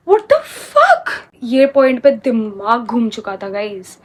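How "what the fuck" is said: very high, slow, laughing outrage; shocked disbelief. Retold or imitated. Reacting as mom apologizes to the bully, stunned. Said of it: "And I was like".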